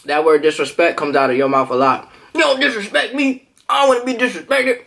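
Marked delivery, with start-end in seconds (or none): 2.4-4.8 put-on drunk tough guy. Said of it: "you know, when you're drunk".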